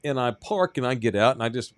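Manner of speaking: usual speaking voice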